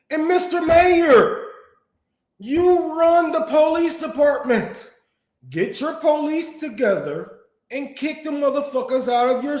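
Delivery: loud, high, furious yelling at the mayor; real angry shouting rant. Real, not acted. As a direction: shout him down, outraged and disbelieving. Ranting back at the mayor.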